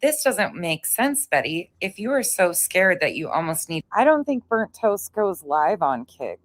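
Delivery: usual speaking voice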